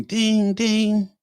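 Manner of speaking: high-pitched sung-out "ding, ding"; doorbell imitation. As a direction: mimic a doorbell chime, high and ringing.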